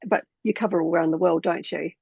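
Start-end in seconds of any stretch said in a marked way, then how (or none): none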